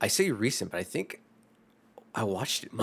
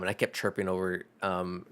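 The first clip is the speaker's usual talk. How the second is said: usual speaking voice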